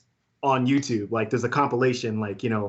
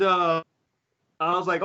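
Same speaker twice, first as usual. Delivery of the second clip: ordinary talk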